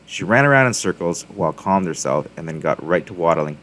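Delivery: plain reading voice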